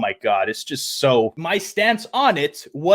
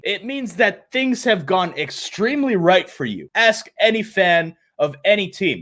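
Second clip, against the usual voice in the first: normal talking voice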